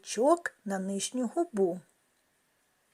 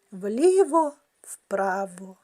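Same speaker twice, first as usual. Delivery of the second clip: Вліво-вправо drawn out slowly, swinging like a pendulum; slow, loud pendulum rhythm; slow counting; 6 dB louder, much slower than usual. Shown as a demonstration. Teacher paces the clock exercise for children. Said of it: "треба рухати ним вліво-вправо".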